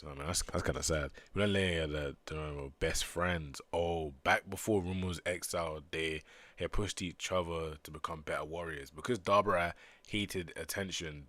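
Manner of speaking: usual speaking voice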